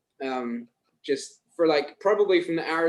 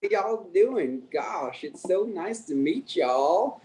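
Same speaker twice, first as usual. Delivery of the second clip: slow, higher Southern accent drawl; 5 semitones higher, much slower than usual. Shown as a demonstration. Scot impersonating an Arkansas greeting, Southern accent. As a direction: stretch it out in a friendly Southern accent. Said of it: "Can you do a Southern accent".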